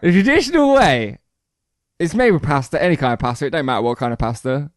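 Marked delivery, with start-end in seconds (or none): 0.0-1.2 high, emphatic proclamation; pitched right up and punched out; emphatic announcement. Real, not acted. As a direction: announce it with mock grandeur for the listener. Repeats it emphatically after being egged on.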